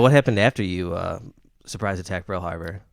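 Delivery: ordinary talk, nothing marked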